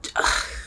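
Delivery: short soft sigh; wordless, low exhaled sigh. Real, not acted. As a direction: breathe out a quiet sigh. Exasperated pause in the rant.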